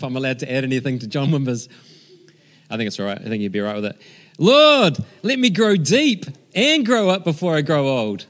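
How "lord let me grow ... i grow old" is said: fervent prayer. Acted. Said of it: "I'd like to add this".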